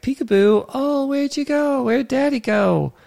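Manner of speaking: high singsong peekaboo voice; playful parent peekaboo. Retold or imitated. Parent playing peekaboo with a small child, high singsong. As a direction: play peekaboo with a toddler. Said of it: "any parent knows. It's like".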